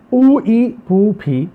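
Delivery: او and ای drawn out and stressed before پھوپھی; vowel demo. Shown as a demonstration. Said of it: "پھوپھی یاد رکھیے". (او ای پر زور دے کر)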